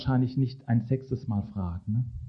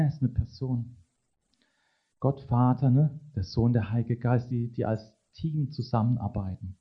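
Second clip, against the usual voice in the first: normal talking voice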